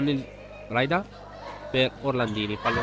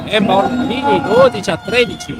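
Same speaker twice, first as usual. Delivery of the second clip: excited call; 5 semitones higher, 5 dB louder than usual. Real, not acted. Point scored, rising call.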